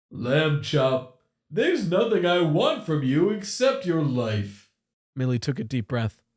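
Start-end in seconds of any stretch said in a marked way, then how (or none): 0.1-4.7 higher, gleeful menacing machine voice, slow at the cruel bits; a playful, menacing animatronic captor; sinister animatronic voice. Acted. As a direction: toy with your trapped prey. Captor refuses her plea.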